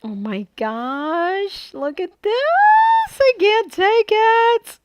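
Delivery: high, slow, thrilled gush; excited squeal. Real, not acted. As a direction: squeal with delight over it. Gushing over her finished album.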